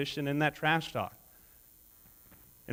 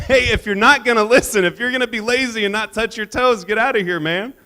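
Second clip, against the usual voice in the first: loud, high teasing shout; mock yelling; 8 semitones higher, 9 dB louder than usual. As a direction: bark at him like a drill sergeant, all in fun. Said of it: "we'd start yelling at him".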